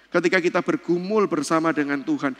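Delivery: ordinary talk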